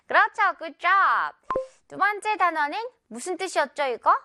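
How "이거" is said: normal talking voice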